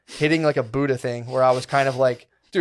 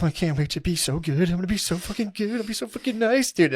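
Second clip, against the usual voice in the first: giddy vow; 6 semitones higher than usual. Retold or imitated. Lying there vowing to be good.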